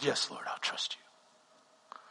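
slow, whispered surrender. Retold or imitated. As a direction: give in softly, barely voiced. Surrendering to God.

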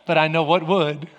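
low, lightly voiced tempter; the tempting enemy. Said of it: "the enemy's going".

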